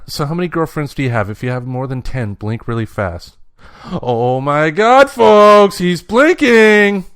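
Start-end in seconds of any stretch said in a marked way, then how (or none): 3.8-7.0 loud, slow and much higher exclamation; excited announcing. Real, not acted. Host telling the audience the boy blinks, loud and slow. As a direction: announce it like breaking news, dragged out.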